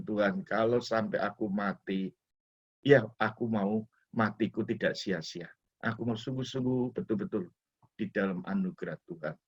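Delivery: hushed, intimate prayer. Retold or imitated. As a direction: pray it low and humble. Retold prayer to God.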